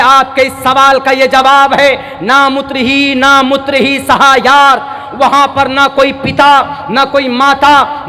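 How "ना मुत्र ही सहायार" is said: usual speaking voice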